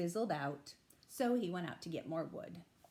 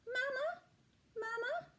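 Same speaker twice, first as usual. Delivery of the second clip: put-on tiny, much higher baby bird calls, rising; gosling peeps. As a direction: peep it like a lost little gosling. Goslings follow bear, calling.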